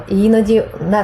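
usual speaking voice

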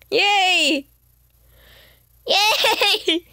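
high, bright little cheer; excited yay. Real, not acted. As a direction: cheer like a delighted new mom. Happy about being a mom.

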